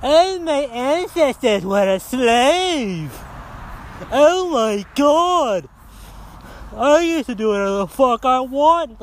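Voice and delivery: slow, exaggerated wailing complaint; mock self-pity. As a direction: drag the words out in a mocking whine.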